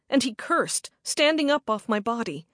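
plain reading voice

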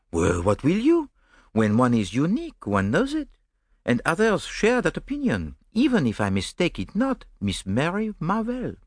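amused, precise Belgian detective; gently mocking his romantic friend; Poirot.

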